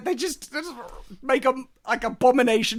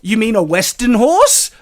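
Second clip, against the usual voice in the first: mock incredulous; 9 dB louder than usual. Mocking the writer's odd phrase.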